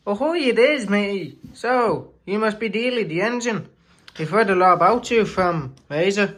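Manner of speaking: put-on high, lively pirate accent. Shed engine welcoming Dealey in a high pirate accent. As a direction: greet him like a cheery pirate. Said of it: "sorry about some of our accents".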